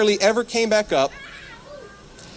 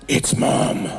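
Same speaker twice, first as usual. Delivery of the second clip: voiceless creepy whisper; eerie whisper. Retold or imitated. Mom behind the door to her son, eerie whisper. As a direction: make it sound ghostly.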